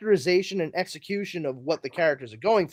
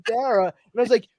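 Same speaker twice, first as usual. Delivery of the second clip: usual speaking voice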